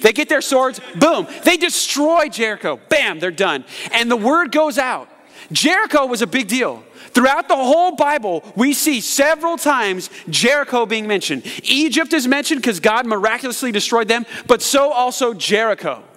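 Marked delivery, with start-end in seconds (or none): none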